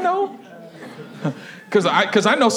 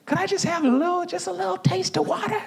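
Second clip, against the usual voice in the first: high, soft, meek pleading; acted sheepish little voice; timid request; 9 semitones higher, 5 dB quieter than usual. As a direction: ask tiny and high, barely daring. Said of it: "He couldn't even bring himself to ask".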